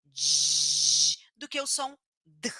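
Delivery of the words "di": slow, stretched dji sound; stretched teacher demo. Shown as a demonstration. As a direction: di drawn out as dji. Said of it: "percebe muito mais esse som".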